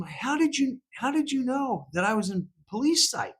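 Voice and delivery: asking puzzled. Retold or imitated. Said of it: "I finally had to ask him".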